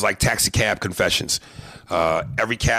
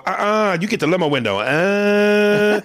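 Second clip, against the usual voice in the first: high, sassy put-on voice; much higher, hammed-up sass; 11 semitones higher, 4 dB louder than usual. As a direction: shut them down like a diva. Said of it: "the character would go".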